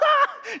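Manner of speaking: high burst of laughter; real laugh. Real, not acted. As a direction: laugh at your own joke. Laugh after joke.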